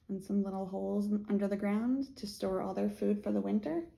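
ordinary delivery

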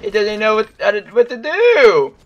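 high, loud gleeful cry; excited laughing shout. Real, not acted. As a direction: crow over the stuck enemy. Mocking a stuck enemy.

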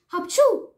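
loud, high achoo; sudden explosive sneeze for the king; acted sneeze. A narrator's reading. The allergic king sneezes again. (strănut puternic)

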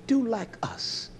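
hushed confiding whisper; secretive retold whisper. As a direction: lean in and whisper it.

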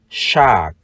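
"shark" with long ah and final k; model pronunciation. Shown as a demonstration. Teacher shows how to read shark, "shark" as long ah plus k. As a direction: say "shark" without a heard r, releasing the k. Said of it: "chúng ta đọc là".

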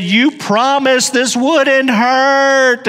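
put-on high, slow, pained child's plea; a father imitating his son crying out in pain; pleading child. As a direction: voice the hurt little boy begging. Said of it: "and he said".

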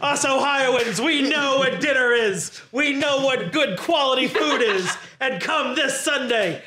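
rallying mock wrestling promo; rally the home crowd with punchy repeated phrases; rookie wrestler promo. Cunning Waiter rallies Ohioans for Sunday, wrestler promo.

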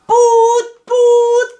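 high, loud imitated honks; truck horn honk. A narrator's reading. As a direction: toot like a lorry in traffic. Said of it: "klaxonna un camion".

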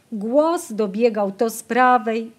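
ordinary delivery, nothing marked

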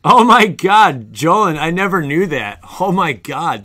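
floored by the news, let it stretch out; stunned. Real, not acted. Stunned by viewer's fact.

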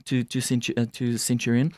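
ordinary delivery

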